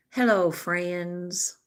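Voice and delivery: very slow, stretched mock greeting; imitated YouTuber greeting. Mimicking YouTubers greeting followers, in a slow drawn-out mock hello. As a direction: stretch each word like those YouTubers. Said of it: "on YouTube that talk like this".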